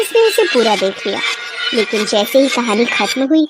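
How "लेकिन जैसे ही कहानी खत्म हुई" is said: plain reading voice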